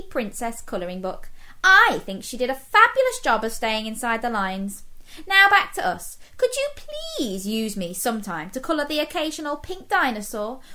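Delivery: high, sassy, indignant crayon. Acted. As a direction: scold the kid with attitude. Reads Pink Crayon's letter.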